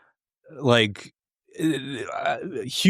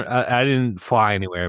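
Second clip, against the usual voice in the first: usual speaking voice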